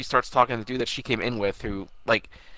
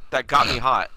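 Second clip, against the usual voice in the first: ordinary talk, nothing marked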